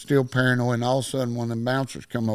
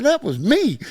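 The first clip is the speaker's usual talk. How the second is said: high, loud correction; emphatic reveal; 11 semitones higher, 5 dB louder than usual. Retold or imitated. Nephew telling his uncle who the boxer was, emphatic reveal. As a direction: set the record straight with gusto.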